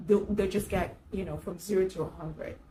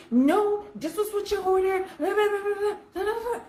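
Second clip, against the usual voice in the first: mock the waitress ranting; waitress insisting on the order, mock shouting; imitated waitress; 11 semitones higher than usual. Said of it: "And she's like".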